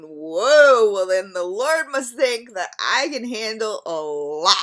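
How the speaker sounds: a loud whoa, then a wry aside; mock surprised whoa. Retelling what she says to herself in hard times, loud mock whoa. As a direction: burst out with whoa, then shrug it off. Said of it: "and I'm going".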